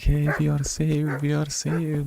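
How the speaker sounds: mock relief. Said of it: "They were like".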